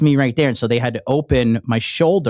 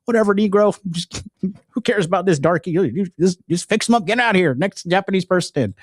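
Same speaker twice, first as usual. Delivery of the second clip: higher, careless mock voice of a rude surgeon; mock dismissive doctor; 7 semitones higher than usual. Vlogger mocking surgeons who rush a foreign patient, dismissive mock voice. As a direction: toss the patient off like he's nothing. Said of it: "They're like".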